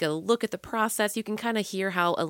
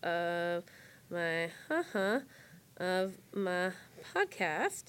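soft, halting, word by word; dictating while typing; 6 dB quieter, slower than usual. Real, not acted. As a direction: murmur each word as it gets typed. Typing a post, saying it aloud.

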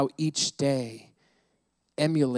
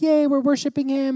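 high, bright mock "yay" in church; mock cheery worship; 12 semitones higher, 5 dB louder than usual. Pastor mocking Sunday churchgoers with a high cheer. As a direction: play the happy churchgoer, way up high.

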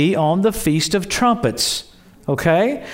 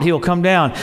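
ordinary delivery

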